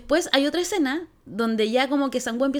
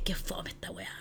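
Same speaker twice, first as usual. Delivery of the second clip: very soft, whispered letdown; retold reaction under the breath; whispered grumble; 13 dB quieter than usual. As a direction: whisper it like a private complaint. Retells her disappointed reaction.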